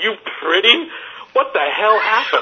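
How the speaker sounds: high, incredulous self-questioning; mock self-reproach. Fan berating himself for his blurt, mock self-reproach. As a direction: replay the blunder in appalled disbelief.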